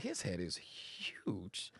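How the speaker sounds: slow whisper of a private thought. Retold or imitated. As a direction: breathe it like a secret.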